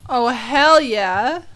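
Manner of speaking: usual speaking voice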